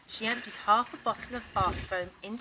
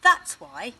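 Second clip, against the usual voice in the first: louder, final put-down; firm mum; 4 semitones higher, 14 dB louder than usual. Acted. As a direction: close the matter firmly. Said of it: "said Missus Large".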